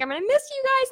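high voice, pretending to hold back tears; imitated tearful goodbye. Daughter phoning parents while hiding tears, imitated tearful voice. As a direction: squeak it out, hiding a mock cry. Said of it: "kind of crying and trying to hide".

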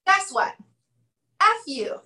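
high, punchy "Guess what?" as a jab; blunt provocation. Retold or imitated. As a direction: call the listener out with a sting. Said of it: "And he goes".

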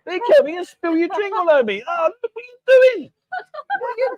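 shocked shout as drink spills; startled outcry. Real, not acted.

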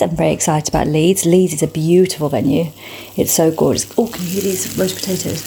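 ordinary talk, nothing marked

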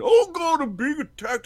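high, loud mock panic. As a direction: squeal it like a hammed-up victim. Retold game character panicking.

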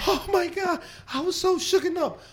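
high, hammed-up startled customer; mock shaken-up gasp. Customer to the TV crew, mock shaken and high. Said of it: "and they go".

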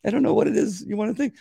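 high, pleading frustration; exasperated. Retold or imitated. Witness frustrated with the court, pitched high. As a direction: insist you know this stuff. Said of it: "I'm going".

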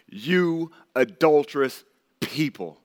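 drawn out, stern and slow; slow, heavy rebuke. Shown as a demonstration. Preacher reading James's rebuke aloud, slow and stern. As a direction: let each word fall slowly like a charge. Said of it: "just listen to the tone with this".

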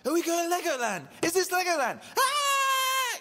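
put-on very high, loud kid squeal; excited child. Dad voicing his son realizing it's Legoland, squealing high. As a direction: squeal with a kid's sudden joy. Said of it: "realization across his face".

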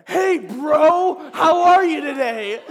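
bright, loud, slowed greeting with a laugh; loud, high mock-friendly hello; imitating David. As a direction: play the overly cheerful buddy strolling up. Friend David greets him cheerfully.

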